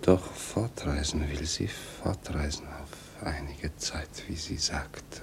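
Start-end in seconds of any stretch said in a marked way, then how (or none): 2.0-5.2 whispered with a bitter edge; hushed and bitter. Acted. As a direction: hiss it quietly, resentful.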